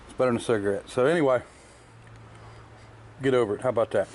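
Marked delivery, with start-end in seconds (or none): none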